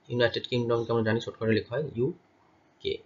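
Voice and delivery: normal talking voice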